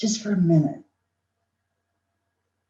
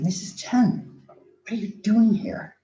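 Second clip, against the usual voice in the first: slow, raised-pitch puzzlement; retold astonishment, pitched higher; surprised question; 5 semitones higher, slower than usual. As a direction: ask it slowly, taken aback. Startled at late visitor, retold.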